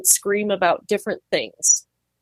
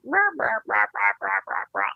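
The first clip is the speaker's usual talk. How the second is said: pig chatter; 4 dB quieter than usual. Retold or imitated. Pig narrating its spa day, mock grumbling. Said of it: "They're like".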